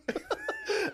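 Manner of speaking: high, soft imitated giggle; tiny squeal of held-in laughter; giggle in class. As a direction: imitate a kid trying not to laugh. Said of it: "I'm sitting there like".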